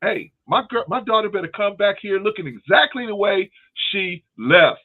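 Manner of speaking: high, pressed warning voice; hammed-up dad laying down the law; protective dad warning. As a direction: warn him like a protective father. Father warning the boy.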